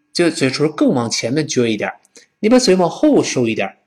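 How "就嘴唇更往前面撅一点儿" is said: ordinary talk, nothing marked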